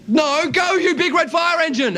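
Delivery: high, cocky shouted correction; acted cocksure call. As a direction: yell it like you own the room. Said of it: "he got a bit cocky and went".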